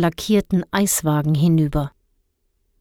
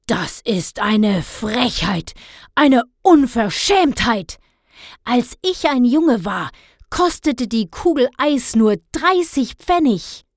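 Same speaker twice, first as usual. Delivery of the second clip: empört polternder Vater, hohe Stimme; lospolternd; 4 semitones higher than usual. A narrator's reading. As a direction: sich gedehnt in helle Empörung hineinsteigern. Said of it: "im nächsten Moment loszupoltern". (aufgebracht lospoltern)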